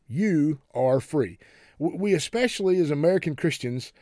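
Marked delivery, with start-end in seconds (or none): 0.1-1.4 "You are free" in slow, separate words; emphatic title announcement. Shown as a demonstration. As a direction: announce "You are free" slowly, weighing each word. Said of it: "Title of this message".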